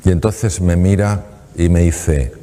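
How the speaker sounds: ordinary talk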